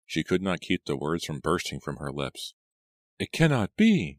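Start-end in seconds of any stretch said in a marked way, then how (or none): 3.2-4.2 high, loud cry of distress; trembling cry. A narrator's reading. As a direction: burst out in anguished disbelief. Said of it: "she cried in a trembling voice".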